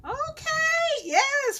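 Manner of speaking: high, bright agreement; excited. Real, not acted. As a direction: cheer it. Greeting viewer comments.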